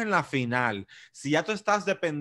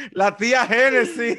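laughing tease; 7 semitones higher, 5 dB louder than usual. Real, not acted. Laughing teasing about aunt.

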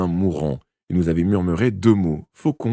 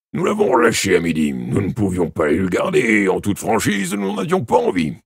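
forceful character voice climbing high at the end; louder inspector voice, rising into exasperation; inspector character, dismissive; 3 semitones higher, 4 dB louder than usual. Acted. Character's reply in story.

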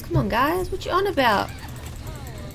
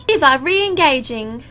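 put-on game callout with an Aussie accent, raised and punchy; 5 dB louder than usual. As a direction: call it out loudly in an Aussie accent. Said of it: "I added an Aussie accent to it".

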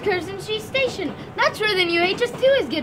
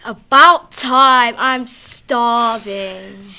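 loud, slow hungry whine; whiny moaning; 7 semitones lower, 9 dB louder, much slower than usual.

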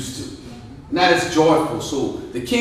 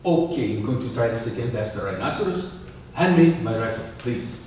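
subdued African king, lower voice; a lower, softer African accent; 5 semitones lower, 5 dB quieter than usual. Acted. King trying the hunt again, subdued African accent.

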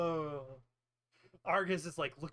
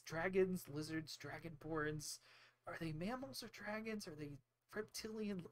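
lightly voiced nerd, mostly softer; nerd wizard; 6 dB quieter than usual. Wizard making notes on dragons and lizards, mildly nerdy. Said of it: "being totally a nerd".

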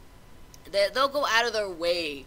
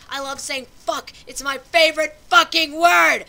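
shouted, delighted punchline; loud gleeful boast; 7 semitones higher, 13 dB louder than usual. Real, not acted. Ranting vlogger proudly declares his favorite swear word, loud. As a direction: belt it out like a proud confession.

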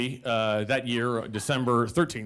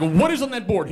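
high, loud challenge; acted indignant question; exasperated demand; 8 semitones higher, 6 dB louder than usual. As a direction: demand an answer through the door. Arguing with roommate.

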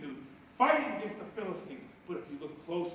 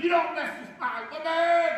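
angry shout; 12 semitones higher, 12 dB louder than usual. Acted. Preacher voicing Samson warning the Philistines, furious shout. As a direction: roar it as furious Samson, high and loud.

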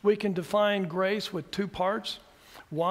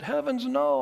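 a sudden, higher, louder cry of denial; startled protest; 8 semitones higher, 5 dB louder than usual. Retold or imitated. To the woman who thought he opposed works, a surprised exclamation. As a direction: jump in to correct her at once. Retold reply to a confused student.

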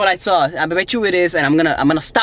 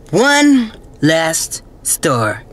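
very slow, each word set apart; dramatic announcement; 4 dB louder, much slower than usual. Pretend. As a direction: draw out every word for drama. Announcing final stop dramatically.

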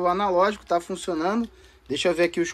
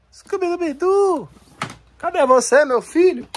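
high, drawn-out cartoon call; Scooby-Doo catchphrase call; 10 semitones higher, 5 dB louder, slower than usual. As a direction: call out high and loud for the dog. (chamado agudo e alongado de desenho animado)

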